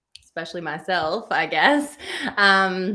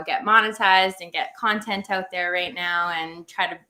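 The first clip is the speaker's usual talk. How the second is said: ordinary talk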